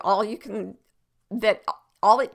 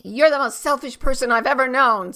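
acted angry outburst, high and loud; loud, raised mock tirade; shouted accusation; 6 semitones higher, 9 dB louder than usual. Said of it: "someone may shout at you".

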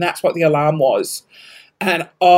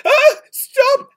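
high, loud mock-panicked yelp; acted-out frantic plea; panicked cry; 5 dB louder than usual. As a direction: squeal at the phone in retold panic. Reenacts panic at the blaring phone.

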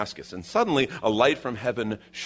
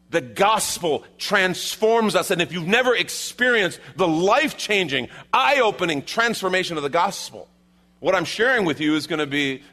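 higher, louder preacher's cry; preaching at full force; 9 semitones higher, 5 dB louder than usual. Acted. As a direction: proclaim it to the congregation.

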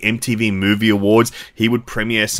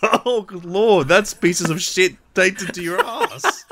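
high laughing through words; 11 semitones higher than usual. Real, not acted. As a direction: crack up mid-sentence. Laughing at the joke.

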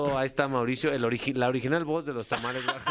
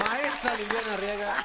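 announcer intro; 5 semitones higher than usual. Real, not acted. Announcing the guest.

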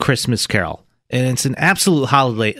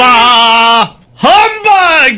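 loud, higher grumpy shout; Scrooge's humbug; 11 semitones higher, 10 dB louder, much slower than usual. Acted. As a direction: bark it out like an angry miser. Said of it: "we did a scene from the film".

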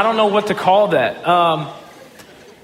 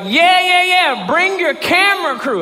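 eager yes; 11 semitones higher, slower than usual. Retold or imitated. Voicing the team's eager yes.

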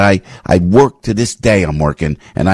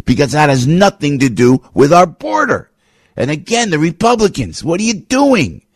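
higher-pitched indignant rant; fired up; 6 semitones higher than usual. Real, not acted. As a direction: scold them, rising into exasperated disbelief. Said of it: "I get fired up".

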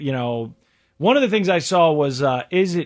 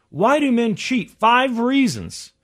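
announcer; 7 semitones higher, slower than usual. Acted. Announces article like a host.

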